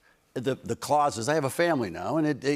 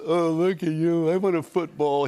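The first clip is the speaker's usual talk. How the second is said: Peyton Manning; 5 semitones higher, 4 dB louder than usual. Retold or imitated. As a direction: draw it out high like a teasing kid. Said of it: "And he's still".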